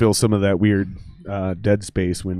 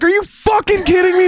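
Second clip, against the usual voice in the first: very high, loud mock yell; mock outraged yell; 7 dB louder than usual. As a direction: blow up in disbelief. His own outraged reply.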